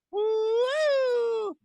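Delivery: very high, gleeful cheer; excited whoop. Real, not acted. Fan cheering a Hamilton goal on replay, very high excited whoop. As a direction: let out a real joyful woo.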